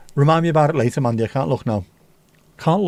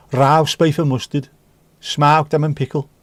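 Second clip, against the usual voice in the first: broad, punchy Brummie accent; hammed-up Birmingham vowels. Shown as a demonstration. Crisp fan reciting old flavours in the required Brummie accent. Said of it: "said in a Brummie accent".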